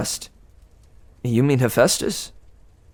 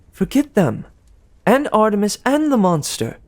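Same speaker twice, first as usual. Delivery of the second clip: higher, sweet gossiping goddess; Aphrodite; 8 semitones higher than usual. Acted. Aphrodite teasing Percy about Annabeth, higher and sweet. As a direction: coo it like a doting goddess.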